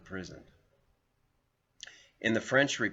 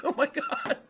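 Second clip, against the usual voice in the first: squealing high laugh through the words; 3 dB louder than usual. Real, not acted. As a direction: crack up while saying it. Laughing at the memory.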